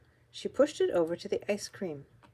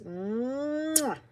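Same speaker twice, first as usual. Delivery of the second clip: long, high hummed smooch; drawn-out mwah; big kiss sound; 7 semitones higher than usual. A narrator's reading. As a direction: squeeze out a long exaggerated kiss noise.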